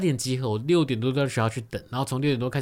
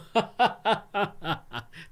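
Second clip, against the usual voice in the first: giddy giggle; 7 semitones higher than usual. Retold or imitated. Inner glee at casting news.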